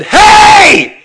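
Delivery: put-on sudden sharp shout at noisy kids; yell. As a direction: bark it out to silence the room. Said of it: "He yelled at them".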